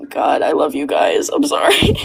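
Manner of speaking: crack, then barely breathe the apology; breaking down crying. Real, not acted. Bug telling his listeners he loves them, breaking down crying. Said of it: "It is okay to cry".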